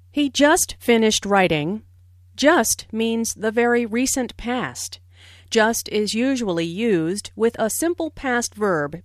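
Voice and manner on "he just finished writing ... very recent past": ordinary talk, nothing marked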